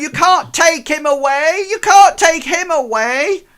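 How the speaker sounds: put-on high, loud shouting street kids; shouting urchins. Urchins yelling at the man holding their friend, high and loud. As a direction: yell it like a pack of angry urchins. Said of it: "start shouting things like".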